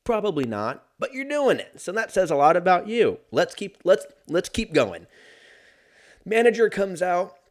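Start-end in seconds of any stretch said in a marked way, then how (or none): 1.0-5.1 worked-up rant. Real, not acted. Ranting at the rude customer.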